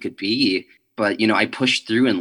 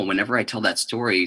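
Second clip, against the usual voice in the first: ordinary talk, nothing marked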